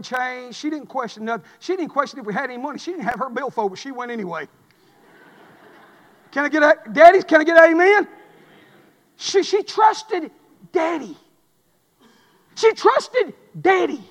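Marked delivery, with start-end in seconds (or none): 6.3-14.0 louder call for an amen, then slow weighty words; preacher's call. Acted. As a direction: rouse the room, then land it slowly. Calling for amen, slowing.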